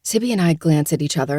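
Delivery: usual speaking voice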